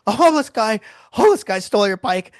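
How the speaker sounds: high, loud, breathless panic; panicked. Retold or imitated. Friend on the phone confessing the stolen bike, high panicked. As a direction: gasp it out like he can barely breathe. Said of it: "panic breathing".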